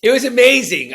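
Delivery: bursts out high and loud with real enthusiasm; genuinely thrilled, nearly shouting; excited. Real, not acted. Guest recalls rehab with the priest.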